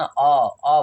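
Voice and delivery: rounded AW on its own, twice; isolated aw sound. Shown as a demonstration. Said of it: "अ बोल रहे हैं". ("अ" को गोल ऑ जैसा अलग से)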